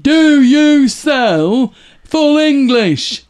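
mocking British tourist. Host mocks British tourists abroad, slow loud caricature. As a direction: ham up the Brit abroad ordering. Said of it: "that type of person tends to stick a Spanish word at the end".

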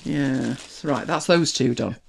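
ordinary talk, nothing marked

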